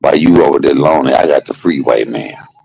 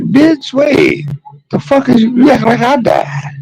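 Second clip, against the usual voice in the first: high, indignant mom retort; scolding mother. Retold or imitated. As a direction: imitate mama putting her crying child straight. Acts mother scolding again.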